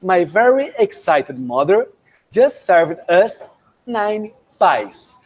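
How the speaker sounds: slow, deliberate English sentence; slow mnemonic demo. Shown as a demonstration. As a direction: spell out each word slowly for memorizing. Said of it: "Eu quero te dar um exemplo agora".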